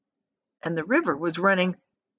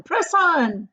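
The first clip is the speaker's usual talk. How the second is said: higher, louder second call; louder urging; 9 semitones higher, 5 dB louder than usual. A narrator's reading. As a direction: push the rowers harder.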